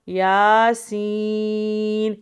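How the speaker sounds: very slow loud drawn chanting of the letters; model recitation. Shown as a demonstration. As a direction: stretch every مد long and full. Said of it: "یا کے اوپر کھڑا زبر ایک الف کے برابر کھینچنا ہے".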